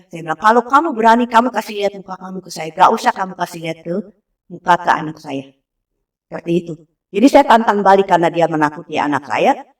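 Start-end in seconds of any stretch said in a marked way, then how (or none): none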